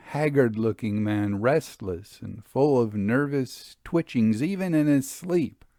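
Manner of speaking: ordinary delivery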